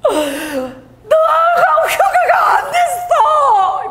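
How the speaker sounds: high, very slow wailing cry; sobbing, stretched shout. Retold or imitated. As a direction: wail it in anguish. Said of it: "이래 울면서".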